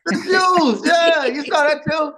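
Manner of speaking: high, loud, amused disbelief; high laughing outcry, drawn out; surprised shout. Real, not acted. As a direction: burst out at the shoe jab. Reacting to the shoe comment.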